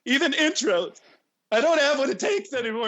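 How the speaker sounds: high, loud despairing whine; mock-tearful lament; comic breakdown. Real, not acted. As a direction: wail in hammed-up self-pity. Comic breakdown over botched intro.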